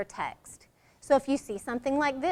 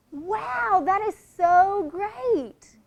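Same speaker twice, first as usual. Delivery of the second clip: high, slow, amazed "Wow, that is so great"; thrilled wow; 7 semitones higher, much slower than usual. Shown as a demonstration. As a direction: draw it out in delight.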